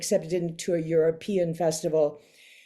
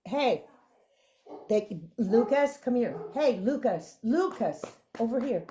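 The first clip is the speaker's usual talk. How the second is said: calling the dogs; 6 semitones higher than usual. Real, not acted. Calling the dogs mid-interview.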